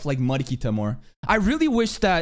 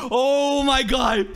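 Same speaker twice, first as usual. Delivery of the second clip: ordinary talk, nothing marked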